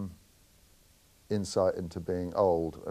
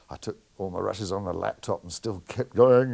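ordinary talk, nothing marked

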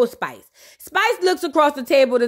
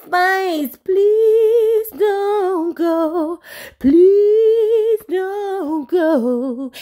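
very slow, drawn-out begging wail; hammed-up plea, stretch every word; mock pleading; 3 semitones higher, much slower than usual. Friends beg Spice to stay, drawn-out mock plea. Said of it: "And they say".

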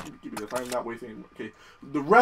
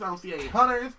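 ordinary delivery

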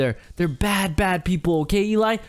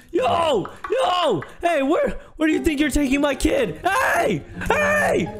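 panicked high yelling after someone; frantic shouting; 7 semitones higher than usual. Acted. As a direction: scream after them in panic. Parent shouting after kidnapped baby.

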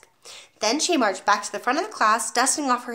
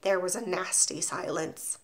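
ordinary delivery

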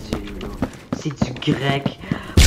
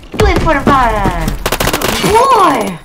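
loud, high mock cheer, dragging out Boy; old-school hype yell; 9 semitones higher, 9 dB louder, slower than usual. Recalling old gamers, hammed-up hype yell. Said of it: "would just go like".

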